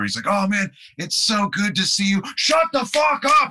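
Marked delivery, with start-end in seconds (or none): 0.3-2.2 high-pitched mock-enthusiastic New York welcome; excited greeting. Singer hyping up the new guitarist, excited greeting. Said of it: "get me excited to do this tour". 2.4-3.5 very high, loud mock yell; angry New York shout. Singer yelling at drummer mid-argument, angry shout. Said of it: "they're having an argument".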